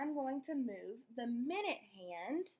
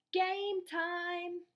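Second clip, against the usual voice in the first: loud, high sing-song call; yelled; 6 semitones higher, 5 dB louder than usual. Acted. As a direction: call the game to start like a referee. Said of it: "Jake looked at his watch and yelled".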